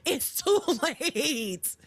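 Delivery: slow drawn-out words broken by a laugh. Real, not acted. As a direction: laugh through it, dragging each word out. Host mocking the too-late line.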